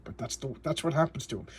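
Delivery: normal talking voice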